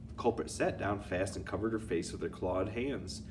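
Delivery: ordinary delivery, nothing marked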